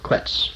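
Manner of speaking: Quetz said alone, softly, as KWETS; soft Quetz with kw start and ts end; pronunciation demo. Shown as a demonstration. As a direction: say Quetz quietly in one syllable, kwets. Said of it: "to me spells Quetz".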